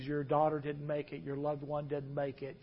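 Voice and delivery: very soft, gentle delivery of a death; hushed bad news. A soft hushed voice breaking the news. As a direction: chaplain quietly telling parents their child died.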